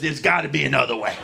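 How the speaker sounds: low, quick, quiet criminal dad; gruff dad voice. Retold or imitated. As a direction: growl it like a shady dealer. Dad pleading with principal.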